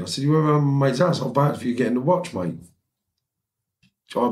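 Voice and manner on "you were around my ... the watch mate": high, exasperated reasoning; indignant retelling, pitched well up; acted protest. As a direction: re-enact pressing your case in a row. Acted quarrel.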